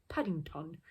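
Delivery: ordinary delivery, nothing marked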